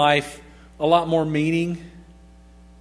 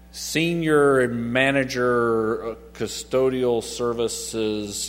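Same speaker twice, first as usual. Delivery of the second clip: mock grand title; 4 semitones lower, much slower than usual. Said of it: "what does that mean".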